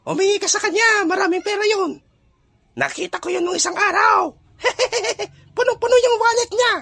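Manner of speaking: very high-pitched cartoonish buddy voice, urging; high put-on voice, egging him on; mischievous scheming friend. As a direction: goad a pal in a squeaky high voice. Plays Goryo urging money request.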